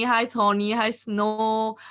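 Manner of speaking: usual speaking voice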